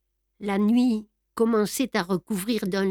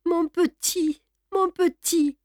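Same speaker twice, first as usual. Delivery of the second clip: put-on high, quavering old servant's voice; old servant stammering in grief; stammering old woman; 8 semitones higher than usual. A narrator's reading. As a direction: stammer it like a shocked old nurse. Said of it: "Victoire balbutiait".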